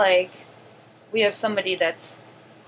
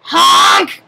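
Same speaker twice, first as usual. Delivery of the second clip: loud clown honk; 8 semitones higher, 9 dB louder than usual. Acted. Clown honks.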